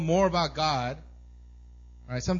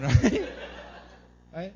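ordinary talk, nothing marked

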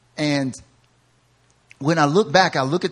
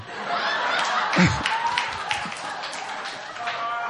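long breathless silent laugh; 2 semitones higher, 7 dB quieter than usual. Real, not acted. Pastor cracking up telling the robe story, silent wheezing laugh.

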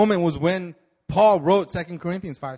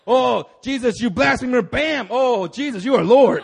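loud outburst; 5 semitones higher, 9 dB louder than usual. Retold or imitated. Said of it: "He was like".

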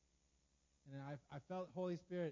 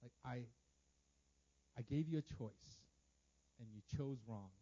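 low, unhurried counsel with pauses; God's gentle correction; 7 semitones lower than usual. Acted. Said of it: "God was telling me".

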